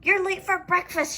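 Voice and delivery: mom's light read-aloud shout; mild shout. A narrator's reading. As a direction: call him down to breakfast. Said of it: "shouted his mom".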